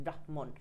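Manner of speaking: ব্রাহ্মণ softly split as brah-mon; brah, then mon, for ব্রাহ্মণ; demonstrated pronunciation. Shown as a demonstration. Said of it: "এরকম আমরা বলি".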